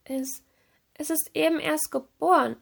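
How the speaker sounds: high, faltering defence; hesitant. A narrator's reading. Serena defending herself to the stern fish, hesitant. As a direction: stumble into a timid excuse.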